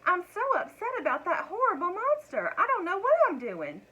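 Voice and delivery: upset wife. A narrator's reading. Flustered wife fears the monster.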